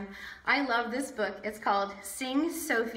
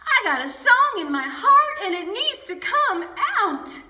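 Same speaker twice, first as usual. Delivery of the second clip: eager declaration; 9 semitones higher, 7 dB louder than usual. Acted. Sophie insists.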